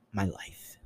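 whispered punchline. Real, not acted. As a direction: drop to a whisper. Confession trailing into a whisper.

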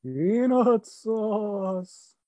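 slow, drawn-out eerie voice; haunt the words, stretched and high; spooky voice. Pretend. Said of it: "Spooky voice or part of voice?".

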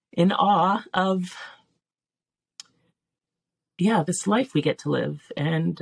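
choked up with gratitude, halting; emotional. Real, not acted. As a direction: fight back grateful tears, pausing between phrases. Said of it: "It's making me emotional".

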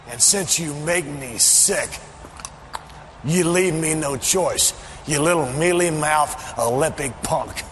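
ordinary talk

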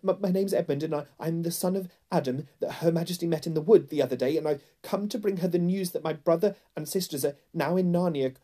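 put-on high, stuttering frightened boy; terrified, halting explanation; trembling. A narrator's reading. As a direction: stammer nervously, pitched high, catching on words. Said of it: "trembling so that he could hardly speak".

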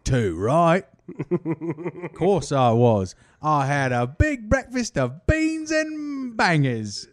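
a hint of a silly British voice, slow; lightly hammed British accent; mock British accent. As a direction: brag about breakfast as a Brit. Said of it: "I'm Andy Murray".

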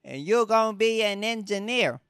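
a hammed-up demanding dad. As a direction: lay down the law like a pushy parent.

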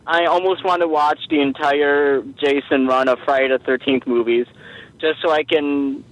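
usual speaking voice

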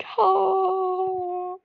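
high silly "Ha!" burst; sharp fake laugh; mock laugh. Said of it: "That was such a lame intro".

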